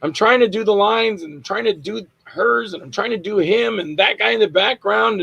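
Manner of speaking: high-pitched reenacted self-defence; flustered excuse. Actor excuses himself to director.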